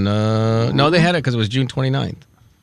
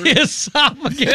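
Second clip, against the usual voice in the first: amused mocking echo; 10 semitones higher than usual. Real, not acted. Mocking wrong word.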